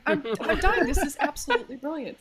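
high, giggly re-enactment of cracking up; high-pitched amused gushing through laughter; laughing out loud. Retold or imitated. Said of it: "I'd be laughing out loud in my car".

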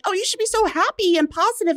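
high, fast, sing-song mock cheerfulness; mocking chirpy quote. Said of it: "Everyone's like".

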